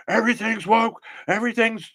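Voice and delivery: high, whiny "everything's woke" rant; mocking ranting critic. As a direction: parody an online outrage guy. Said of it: "them people who's a flamethrower, is like".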